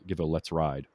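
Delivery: ordinary talk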